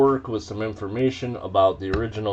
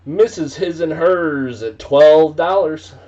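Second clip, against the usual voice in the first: loud auction call; 7 semitones higher, 10 dB louder than usual. Real, not acted. Auctioneer calls out a bid.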